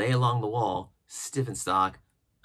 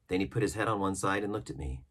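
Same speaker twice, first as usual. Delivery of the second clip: usual speaking voice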